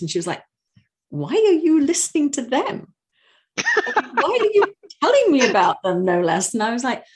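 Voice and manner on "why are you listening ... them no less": imitating a friend's baffled question, high-pitched; retold disbelief, pitched up with a laugh breaking in; incredulous. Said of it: "she was just incredulous".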